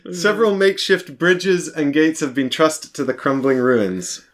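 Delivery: slow storyteller reading; scene narration. Acted. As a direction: set the scene slowly. Reads game scene text.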